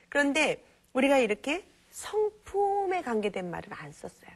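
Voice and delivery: ordinary delivery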